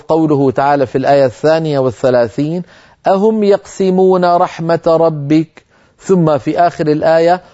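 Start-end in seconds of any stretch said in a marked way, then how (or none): 3.1-5.6 slow, melodic recitation; Quran recitation. Acted. Teacher quotes the verse in recitation tone.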